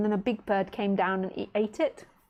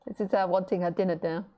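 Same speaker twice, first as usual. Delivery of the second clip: ordinary talk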